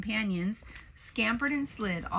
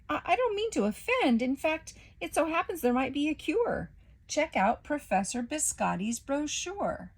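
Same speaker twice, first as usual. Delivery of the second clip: flustered baron voice; 6 semitones higher than usual. A narrator's reading. Waffle backpedals nervously.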